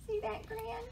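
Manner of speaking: high, alarmed mouse voice; high-pitched storybook fright; startled mouse. A narrator's reading. Frightened mouse sees a grin.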